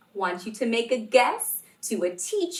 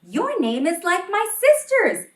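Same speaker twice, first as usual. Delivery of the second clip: put-on excited kid; 9 semitones higher, 8 dB louder than usual. Excited classmate connects.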